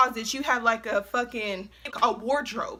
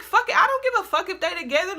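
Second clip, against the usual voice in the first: angry retort; 6 semitones higher, faster than usual. Retold or imitated. Angry retort in retold quarrel.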